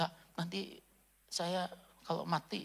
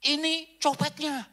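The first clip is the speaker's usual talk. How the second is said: loud, high, slow accusation; accusing shout; 11 semitones higher, 8 dB louder, slower than usual. Retold or imitated. American aunt accusing the pastor in the market, shouting. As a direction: point and cry out at the thief. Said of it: "Tantenya itu yang ngomong".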